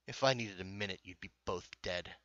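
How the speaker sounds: lightly voiced threat, low and quiet; understated, cold intimidation; menacing game character.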